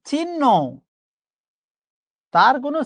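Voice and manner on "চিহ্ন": "চিহ্ন" said chin-no, higher pitch; pronunciation demo. Shown as a demonstration. Teacher showing how we say it, "চিহ্ন" as high chin-no. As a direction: raise "চিহ্ন" and double the n: chin-no. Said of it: "আমরা উচ্চারণ করি".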